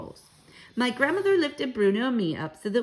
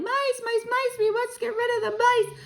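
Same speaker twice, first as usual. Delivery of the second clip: high, frantic yelling; yelling chefs; 3 dB louder than usual. Acted. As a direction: shout it while chasing mice. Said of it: "everyone was yelling".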